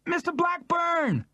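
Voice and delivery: a small woman screaming for him; determined scream. Acted. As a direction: cut through the racket. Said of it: "screamed above the racket".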